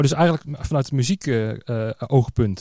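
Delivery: ordinary talk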